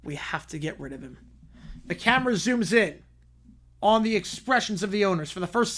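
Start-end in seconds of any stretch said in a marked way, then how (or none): 0.0-1.2 low, soft, very calm; calm and quiet. Acted. Owner Frank calmly plotting against the commissioner, low and soft. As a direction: say it softly, coldly calm. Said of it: "Frank is being very calm".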